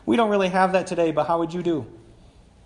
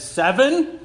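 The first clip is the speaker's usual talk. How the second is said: high, louder questioning "seven", proud of the offer; a mock-generous bid, pitched up; self-satisfied offer; 7 semitones higher, 5 dB louder than usual. Peter offers Jesus a number, thinking he's magnanimous, self-satisfied. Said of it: "thinking he's being magnanimous".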